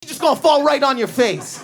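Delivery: high mock hustler, pushed harder; a high, pushy street hustle; turned-up hustle. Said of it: "He goes".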